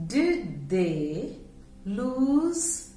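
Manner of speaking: very slow, each word laid out for repeating; slow careful teacher demo of lose; slow model sentence. Shown as a demonstration. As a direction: say it slowly so students can copy. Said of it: "ध्यान से आप सुनिए".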